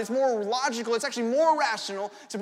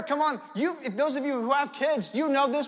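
ordinary talk

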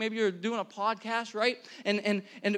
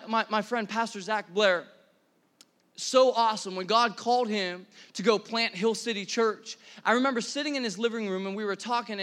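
normal talking voice

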